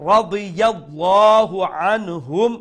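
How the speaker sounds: slow, loud recitation of "রাদিয়াল্লাহু আনহুম" with distinct y glide; careful model recitation, clear ya in "রাদিয়াল্লাহু"; correct ya, clear ain. Shown as a demonstration. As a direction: stretch "রাদিয়াল্লাহু", clean n in "আনহুম". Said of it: "খেয়াল রাখবা যাতে ইয়ার উচ্চারণ".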